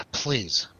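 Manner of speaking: ordinary talk, nothing marked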